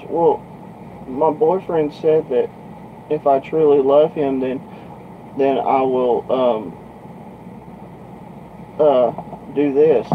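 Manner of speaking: imitated hesitant girl. Girl explaining her boyfriend's demand to trucker, imitated hesitant voice. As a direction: mimic a naive girl explaining with pauses.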